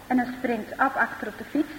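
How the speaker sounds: plain reading voice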